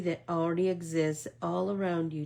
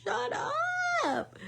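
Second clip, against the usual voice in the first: high squealed disbelief; a delighted, high-pitched gasp of disbelief; shocked delight. Retold or imitated. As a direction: squeal it, amazed and thrilled. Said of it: "I was like".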